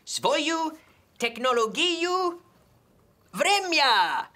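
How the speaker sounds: hammed-up Russian phrase, loud at the end; mock Russian. Tech show host fakes Russian, loud finish. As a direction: play at speaking Russian. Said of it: "In Russian".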